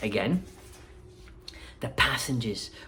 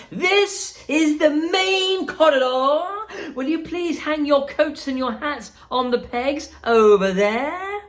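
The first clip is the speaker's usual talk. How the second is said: high, louder showman calling over noise; raised voice; 12 semitones higher, 5 dB louder than usual. Acted. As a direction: call out over the machines. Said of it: "raising his voice above the noise".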